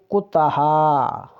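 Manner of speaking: कुतहा with short u, long final aa; teacher sounding out कुतहा; pronunciation demo. Shown as a demonstration. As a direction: draw out the ending of कुतहा slowly. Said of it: "छोटे ऊ की मात्रा का जब हम उच्चारण करते".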